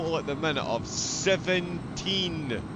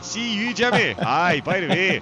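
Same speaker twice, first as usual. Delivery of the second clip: higher, louder hammed-up Scottish; fake Scottish accent; 5 semitones higher, 7 dB louder than usual. Commentator jokes with his teammate in a fake Scottish accent. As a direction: ham up a Scots greeting with a laugh. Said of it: "my fake Scottish accent".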